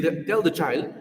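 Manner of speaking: ordinary delivery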